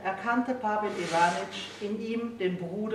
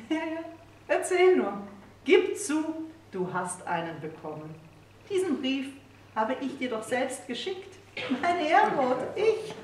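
much higher, mocking playful wife; triumphant teasing, voice climbing; 6 semitones higher than usual. A narrator's reading. Wife teasing her husband about the letter, high and mocking.

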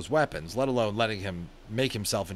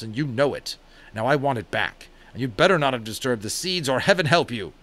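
a raised, stern father's scolding; cross-examine the boy sternly; father scolding his son about the greenhouse; angry father; 5 semitones higher, 5 dB louder than usual. A narrator's reading. Father interrogates Omri.

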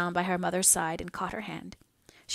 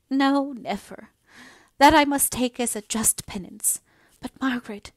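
anguished sick mother; 5 semitones higher, 6 dB louder than usual. A narrator's reading. Mother refusing hope of going home, anguished. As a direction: draw out the refusal in despair.

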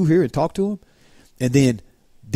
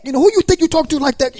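put-on high, sharp old-school rebuke; indignant scolding; 13 semitones higher, 3 dB louder than usual. Scolding a student who cussed the librarian, high and indignant. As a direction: put them in their place. Confronting the rude student, retold.

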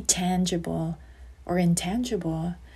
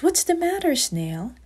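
higher, lightly voiced storybook ant; a hint of a small character's worry; concerned ant; 8 semitones higher than usual. A narrator's reading. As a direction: ask with gentle concern, pitched up. Ant asks crying snail, story voice.

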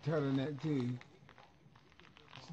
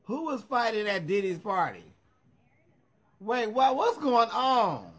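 higher, louder burst of disbelief; surprised questioning; 6 semitones higher, 4 dB louder than usual. Real, not acted. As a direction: demand the gossip, voice jumping up. Startled by news of party fight.

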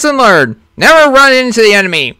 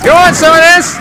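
battle taunt; 7 semitones higher, 3 dB louder than usual. Acted.

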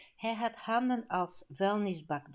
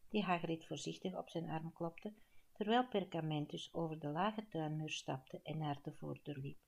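ordinary delivery, nothing marked

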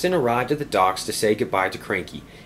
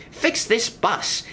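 slow command; 13 semitones higher, much slower than usual. Acted. Inspector orders.

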